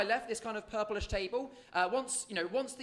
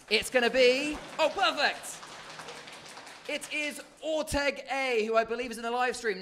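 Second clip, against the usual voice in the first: hyped giveaway announcement; 5 semitones higher, 5 dB louder than usual. Real, not acted. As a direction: build suspense, then announce the winner. Announcing giveaway winner live.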